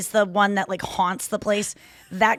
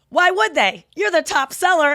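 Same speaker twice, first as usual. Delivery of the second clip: high, loud snappy comeback; mocking retort; 8 semitones higher, 6 dB louder than usual. The guy topping George's comeback, mocking retort. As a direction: throw it back at him, high and loud. Colleague fires back at George.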